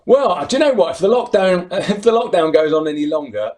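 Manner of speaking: loud, high, laughing burst; embarrassed laugh. Real, not acted. Shown embarrassing old photo.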